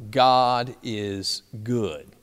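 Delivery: slow, spaced-out words, falling to a close; campers' measured response. Retold or imitated. Campers responding to the camp director, slow measured answer. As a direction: answer word by word at normal volume. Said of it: "the campers would respond".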